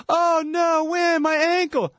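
high whiny fake-injury wail; hammed-up high fake pain; mock whining soccer player. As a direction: whimper like a diving footballer. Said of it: "where they go".

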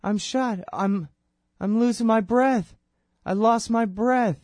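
high, pleading, breathless plea; desperate gasp. Retold or imitated. Victim pinned down pleading to cops, high and desperate. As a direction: beg for air as he's losing breath. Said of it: "And the guy's saying".

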